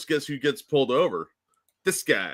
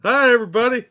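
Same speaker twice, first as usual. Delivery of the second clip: bright, higher, louder on-air hello; radio guest greeting; 8 semitones higher, 6 dB louder, faster than usual. Pretend. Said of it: "Say hi, [name]".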